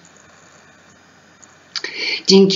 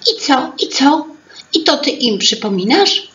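lively teapot voice; 6 semitones higher than usual. Acted. Teapot interrupts eagerly.

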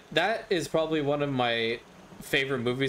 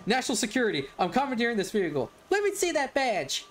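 higher, urgent cop voice, fast at first; hammed-up cop; 10 semitones higher than usual. Retelling the scene, the cop demands the car in a higher voice. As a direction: play the pushy cop ordering her out.

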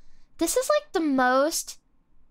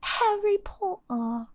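slow, higher amateur British accent; 6 semitones higher than usual. Shown as a demonstration. Streamer testing her weak British, drawn-out British accent. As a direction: draw it out in rough British. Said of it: "accent is not very good".

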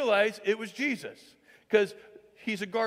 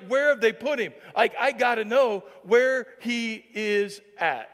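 slow, pleading, voice pushed a little louder; desperate plea; 3 semitones higher, 6 dB louder, slower than usual. Retold or imitated. Mary frantic at the empty tomb, slow desperate plea. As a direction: beg to know where he is. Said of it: "she's like".

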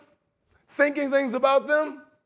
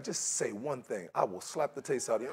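low, soft, menacing undertone; quiet angry threat; 10 semitones lower, 13 dB quieter than usual. Acted. Preacher acting someone angry before meeting, quiet low threat. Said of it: "I'm angry".

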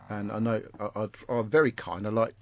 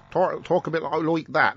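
put-on West Country accent; 6 semitones higher, 5 dB louder than usual. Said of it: "will sound very West Country".

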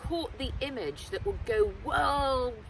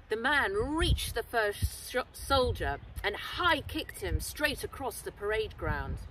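ordinary delivery

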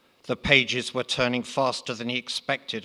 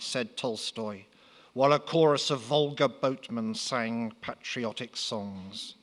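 ordinary delivery

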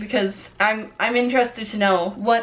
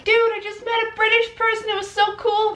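very high-pitched gushing fan; exaggerated starstruck squeal; mock excitement; 11 semitones higher than usual. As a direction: imitate a thrilled stranger, voice way up. Acted excited person retelling encounter.

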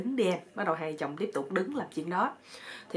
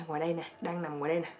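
normal talking voice

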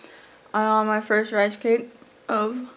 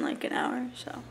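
ordinary talk, nothing marked

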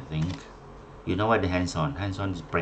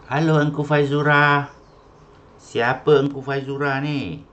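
loud, high-pitched roll call; 7 semitones higher, 7 dB louder than usual. Real, not acted. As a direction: call out to someone who isn't answering. Calling an unknown, silent attendee.

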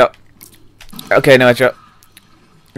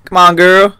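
louder, high-pitched urging call; raised voice, pitched up; 7 semitones higher, 5 dB louder, slower than usual. Real, not acted. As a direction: call out to hurry a companion along. Urging game companion on.

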